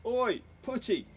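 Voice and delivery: high, loud summons; calling out. A narrator's reading. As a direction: holler for someone offstage. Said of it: "Punch called".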